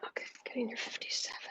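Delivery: quick unvoiced whisper; fast whisper. Real, not acted. Counting quietly to herself during the stream, a fast whisper. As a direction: rattle it off under your breath.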